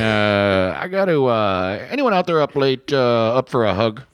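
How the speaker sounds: high trucker radio call-out. Pretend. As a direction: call out over the radio channel.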